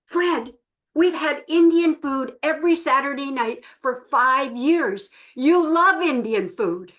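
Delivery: wife protesting loudly at her husband; exasperated protest. Retold or imitated. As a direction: push back in disbelief, raising the voice. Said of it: "And she says".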